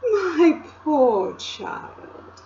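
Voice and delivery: slow, high, honeyed queen voice; a sudden sweet voice from the witch; different voice. A narrator's reading. Queen softening to the shivering boy, high and slow. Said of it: "she said in quite a different voice".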